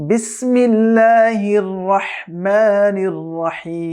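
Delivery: slow, drawn-out chanted Bismillah; correct recitation. Shown as a demonstration. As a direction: chant each word slowly and precisely. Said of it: "এটি হচ্ছে বিসমিল্লাহর সহি শুদ্ধ উচ্চারণ". (ধীর সুরেলা শুদ্ধ তিলাওয়াত)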